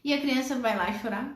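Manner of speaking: ordinary talk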